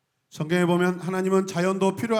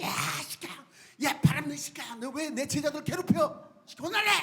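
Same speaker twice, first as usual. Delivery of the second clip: higher, hammed-up angry rebuke; mock furious, higher voice; hot-tempered scolding; 8 semitones higher, 4 dB quieter than usual. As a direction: play a thug yelling at the wind. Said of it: "막 성질 내면서".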